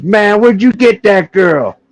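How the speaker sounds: high, slow amazed buddy voice. Retold or imitated. Buddy back from the bedroom, stunned and high-pitched.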